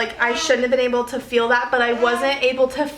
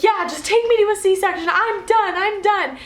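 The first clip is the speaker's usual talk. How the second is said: desperate giving up; 8 semitones higher than usual. Retold or imitated. Giving up in labor, begging.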